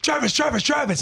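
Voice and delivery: high, carrying call of a name. Retold or imitated. Reporter calling to a player from the line. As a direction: shout to catch his eye. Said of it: "and I'm like".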